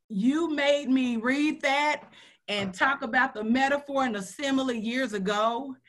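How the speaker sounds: usual speaking voice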